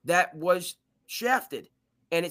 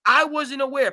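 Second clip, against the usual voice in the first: higher and louder, disbelieving; incredulous; 7 semitones higher, 7 dB louder than usual. Real, not acted. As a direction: throw up your hands in disbelief. Incredulous rant about Seattle.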